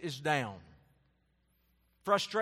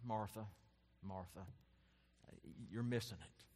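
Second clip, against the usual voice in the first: softer, lower, gentle calling of her name; gentle, not angry; 6 semitones lower, 11 dB quieter than usual. Retold or imitated. Jesus softly calling Martha back, gentle. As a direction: coax her attention kindly, not scolding. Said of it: "I don't think he's angry. I think he's like".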